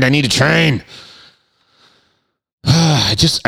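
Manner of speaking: ordinary delivery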